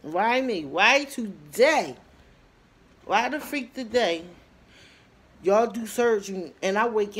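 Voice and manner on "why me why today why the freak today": loud, higher cry of why me; loud pleading why today; retold despair. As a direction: wail it like waking up in pain. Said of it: "I was like".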